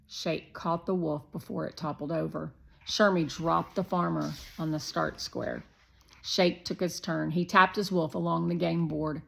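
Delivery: ordinary delivery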